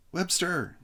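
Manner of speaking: high call across the field. Acted. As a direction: call out to him. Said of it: "called Chip".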